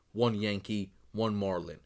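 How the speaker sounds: usual speaking voice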